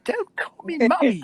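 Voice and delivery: put-on high, squealing old-lady voice; indignant old woman. Homeless woman objecting to the guard, high mock indignation.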